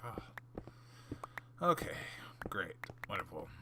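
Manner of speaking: hushed mock-cheerful whisper; sarcastic whisper. To the viewers as a fight starts, sarcastic whisper. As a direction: whisper it with fake delight.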